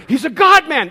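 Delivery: high, loud announcement to everyone; hammed-up loud outburst; imitated excited yell. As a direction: proclaim it wildly while shaking someone. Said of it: "he grabs a woman in the row".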